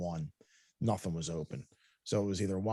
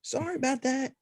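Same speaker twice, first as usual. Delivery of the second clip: high, bubbly cashier voice; high chirpy apology from the young cashier; goofy young cashier. Retold or imitated. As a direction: play the silly girl at the window. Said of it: "She was like".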